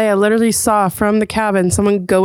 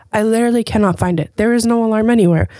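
ordinary talk, nothing marked

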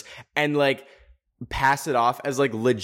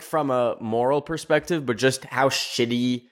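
usual speaking voice

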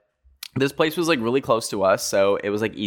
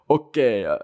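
mock French accent; imitating a French shop owner. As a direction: slip into a put-on French accent. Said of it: "we're not doing the accent".

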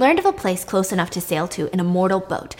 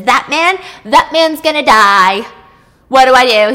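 louder, pleading and upset; distressed near tears; 3 semitones higher, 8 dB louder than usual. Acted. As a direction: panic rising toward tears. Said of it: "she's nearly in tears".

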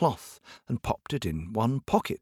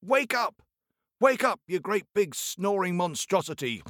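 calling out; 8 semitones higher than usual. Acted. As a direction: yell him awake. Tailor rousing the giant.

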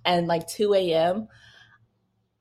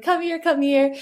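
mild mock yell, pitched up high; high, repeated summons in a half-voiced yell; retold yell; 8 semitones higher than usual. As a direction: imitate calling someone over urgently, without full volume. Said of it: "yell at him to come here".

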